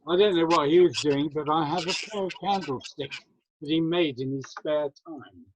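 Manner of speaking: usual speaking voice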